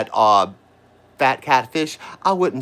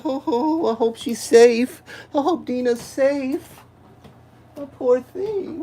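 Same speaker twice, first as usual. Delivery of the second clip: mock concern; 10 semitones higher, slower than usual. Mocking concern for Dina.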